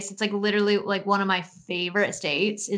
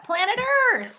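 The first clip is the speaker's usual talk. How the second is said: excited. Real, not acted. Revealing the mom's show.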